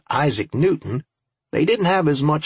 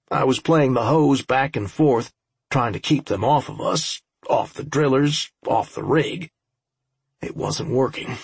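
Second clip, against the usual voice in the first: ordinary delivery, nothing marked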